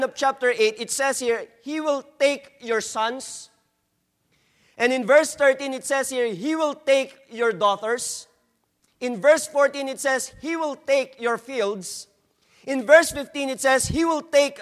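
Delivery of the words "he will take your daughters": normal talking voice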